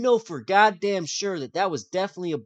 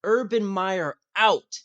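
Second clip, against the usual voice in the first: announcing the headline; 5 semitones higher, 4 dB louder, much slower than usual. Retold or imitated. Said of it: "Shefty said".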